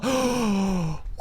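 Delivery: big drawn-in gasp; excited gasp. Real, not acted. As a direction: gasp in amazement. Gasp after recognizing the characters.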